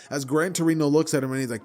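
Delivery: ordinary delivery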